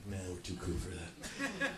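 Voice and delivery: slow, lazy dismissive drawl; mock the aloof crowd, bored and slow; lazy mock-cool brush-off; too cool for school. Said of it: "You guys are like".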